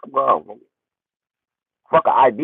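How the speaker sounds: ordinary delivery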